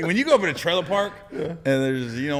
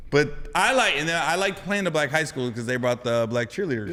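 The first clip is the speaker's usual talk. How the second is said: ordinary talk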